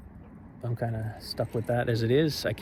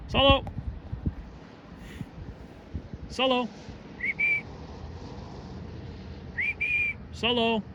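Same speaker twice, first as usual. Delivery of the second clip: calling out; 13 semitones higher, 3 dB quieter than usual. Real, not acted. Calling dog across park.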